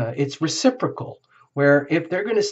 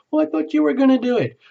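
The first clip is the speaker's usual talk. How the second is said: indignant blame; 12 semitones higher, faster than usual. Pretend. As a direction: throw the blame back, pitched high and fast. Partner's acted blame in a quarrel.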